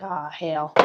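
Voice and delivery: stunned muttered curse. Retold or imitated. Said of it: "Then she like".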